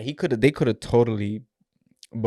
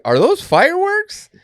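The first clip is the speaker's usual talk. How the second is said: very high, slow, louder disbelieving cop; mock cop; 8 dB louder, slower than usual. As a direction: drag it out in a squeaky cop voice. Retelling the cop's question.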